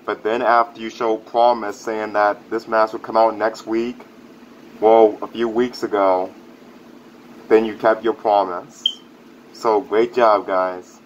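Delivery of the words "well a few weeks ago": ordinary talk, nothing marked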